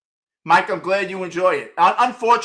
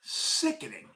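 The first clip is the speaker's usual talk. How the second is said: disgusted whisper; 8 semitones higher, 12 dB quieter, slower than usual. Real, not acted. Disgusted aside after rant.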